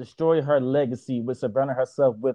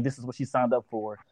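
softer, dismissive voicing of what people say; a hint of the know-it-all commenters; mocking quote; 2 semitones lower, 7 dB quieter than usual. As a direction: mildly mimic the critics' line. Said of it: "say things like".

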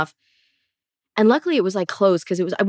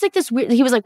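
normal talking voice

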